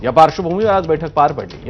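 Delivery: ordinary talk, nothing marked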